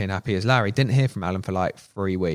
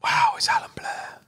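breathless awed whisper, much higher; starstruck whisper. Retold or imitated. Scene: mocking fans who are starstruck by the boss, awed whisper. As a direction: gasp the name like a fan meeting a star.

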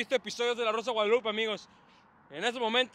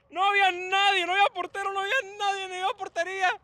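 high-pitched wailing complaint; incredulous lament; 8 semitones higher, faster than usual. Real, not acted. Fan lamenting a miss with no keeper, incredulous lament. Lamenting open goal missed.

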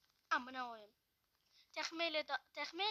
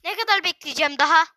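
much louder, furious complaint at the phone; angry rant; 16 dB louder than usual. Real, not acted. Gamer fed up with his lagging phone, loud angry rant. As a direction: rant like you're about to smash the screen.

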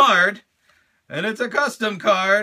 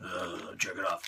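low figure voice; 12 semitones lower, 13 dB quieter, faster than usual. Acted. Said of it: "He's like".

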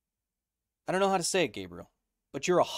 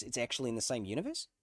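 ordinary delivery

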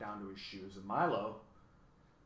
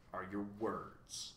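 disdainful; 3 semitones lower, 8 dB quieter, much slower than usual. A narrator's reading. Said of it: "handing Milo the last of them disdainfully".

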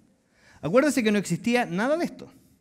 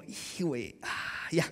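muttered, much softer, half whispered; unimpressed aside; 2 semitones lower, 11 dB quieter, slower than usual. Retold or imitated. Listener quietly brushing off the eager believer, half whispered. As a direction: shrug it off under your breath. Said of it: "Y tú estás así como".

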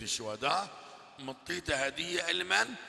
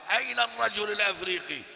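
calling out; 5 semitones higher, 5 dB louder than usual. Retold or imitated. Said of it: "صرخ الإمام من وراء الباب".

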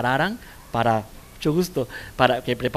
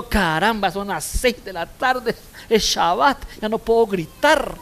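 mock exasperated outburst; 6 semitones higher, 4 dB louder than usual. Yelling mother checks herself.

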